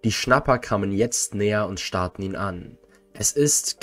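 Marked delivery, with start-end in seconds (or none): 3.1-3.8 slightly croaked, high-pitched start; a touch of a croak, pitched up; croaked discovery. A narrator's reading. Greyback announces they caught Potter, a mild high croak. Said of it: "krächzte Greyback".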